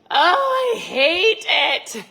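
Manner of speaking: high, loud and dragged-out whine; whiny dread. Retold or imitated. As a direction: moan it like you're dreading the run. Dreading the running, groaning.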